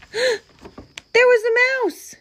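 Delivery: high, drawn-out gasp of surprise; gasp, then squeal it slowly in alarm; startled storyteller, voice leaping right up; shocked discovery. A narrator's reading. Narrator's shock at finding mouse.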